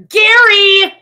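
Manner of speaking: high, loud demanding yell; hammed-up shouted tantrum; Amber. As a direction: bellow the name like an angry reality star. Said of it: "can we get a quick Teen Mom Amber impression?".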